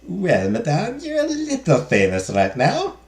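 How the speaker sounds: put-on high maid voice with a shaky French accent; attempted French accent. Maid tells madame she is famous, attempted French accent. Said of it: "I'm trying for a French accent".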